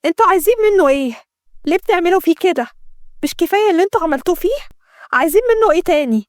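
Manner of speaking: high-pitched, agitated demands; angry. A narrator's reading. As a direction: confront the captors, upset and indignant. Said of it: "ليلى اتكلمت بعصبية". (بعصبية وانفعال)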